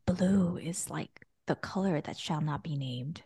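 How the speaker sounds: slow hushed whisper; whispering on the phone. Real, not acted. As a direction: whisper like sharing a secret. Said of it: "So why are you whispering?".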